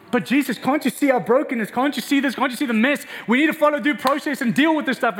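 a hint of an anxious churchgoer pleading; pleading church. Church answering Jesus, lightly voiced pleading.

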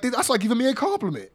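Waller impression. Waller spins the insult, cheeky impression. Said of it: "Waller, of course".

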